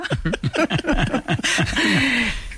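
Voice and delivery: soft amused laugh. Real, not acted. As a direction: laugh quietly at your own story. Laughing at the nun anecdote.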